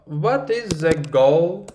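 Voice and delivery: ordinary talk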